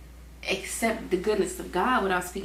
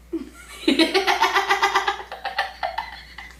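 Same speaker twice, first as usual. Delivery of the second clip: loud real laughter; cracking up; long laughing fit; 11 semitones higher, 5 dB louder than usual. Real, not acted. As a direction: laugh hard. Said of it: "Lord, that's funny".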